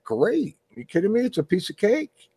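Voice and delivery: high-pitched, lightly voiced breezy reply; acted breezy bluff. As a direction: insist it's easy, voice lifted high. Acted bluffing reply to sarcastic coworker.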